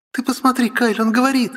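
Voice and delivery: put-on very high, excited girl's voice; delighted. Sister shows brother the talking baby, high excited voice. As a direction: exclaim with high-pitched delight.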